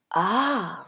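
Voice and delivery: ordinary delivery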